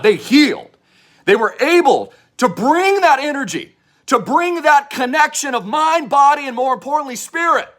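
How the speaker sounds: high, loud rallying cry to the crowd; revival-tent hype preacher; fired-up preaching. Acted. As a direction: whip the crowd into a frenzy. Preaching swell on healing.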